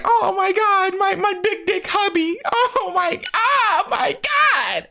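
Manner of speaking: very high, seductive possessed falsetto; possessed entity voice. Retold or imitated. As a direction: squeal it like the demon inside her has taken over. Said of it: "she goes back into the entity".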